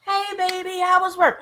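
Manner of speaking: high, sweet greeting from a cheerful wife; happy wife. Acted. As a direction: welcome your husband home brightly. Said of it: "Elizabeth's all happy".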